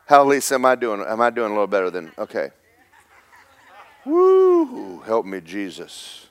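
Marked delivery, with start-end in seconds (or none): 4.0-5.0 high, loud whoop of excitement; excited preacher whoop. Real, not acted. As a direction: let out a joyful holler.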